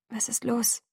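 soft, hushed, careful question; whispered question. A narrator's reading. Bella asks the brooding driver, hushed. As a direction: ask softly, nearly whispering. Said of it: "Ich flüsterte".

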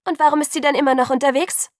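high, loud, accusing question; angry challenge. A narrator's reading. Alice challenges Jacob's boast, angry and loud. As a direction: throw his claim back at him.

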